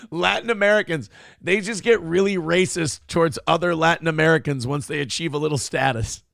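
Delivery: ordinary delivery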